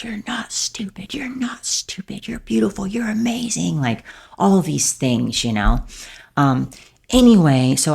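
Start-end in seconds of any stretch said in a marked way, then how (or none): 0.0-3.8 hushed, breaking whisper from a crying brother; tearful whisper. Retold or imitated. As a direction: whisper comfort through tears. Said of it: "broke down crying".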